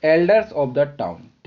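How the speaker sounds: slow repeat of the answer; slow dictation. Shown as a demonstration. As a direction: say the answer again slowly. (धीमे दोहराया गया उत्तर)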